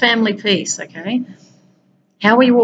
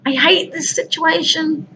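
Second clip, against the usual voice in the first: much higher, demonstrating pent-up anger; venting anger demo; 9 semitones higher than usual. Shown as a demonstration. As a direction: act out letting the anger out, pitched high. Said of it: "So all anger has to come out".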